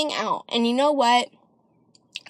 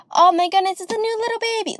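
high, loud doting coo that climbs even higher; cooing over a baby; 8 semitones higher, 3 dB louder, faster than usual. Retold or imitated. Relatives fussing over the new baby, high doting coo. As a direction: gush like a relative over a newborn. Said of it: "everybody adores you".

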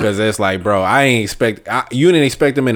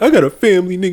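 [name] impression; 6 semitones higher than usual. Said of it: "He was like, Andre three thousand".

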